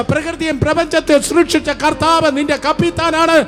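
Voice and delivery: high-pitched, loud sermon building to a shout; full-force preaching. Real, not acted. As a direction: preach it out, driving up to a climax. (ആവേശത്തോടെ ഉച്ചത്തിലുള്ള പ്രസംഗം)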